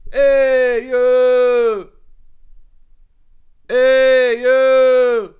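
high, drawn-out holler; mock screaming at window. Man on the sidewalk calls up to the balcony, high mock holler. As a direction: imitate a guy hollering up to a balcony. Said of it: "Fucking screaming at the window".

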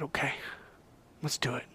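very soft, near whisper; quiet solemn yes; hushed acceptance. A narrator's reading. Jesus quietly agreeing to become human, hushed.

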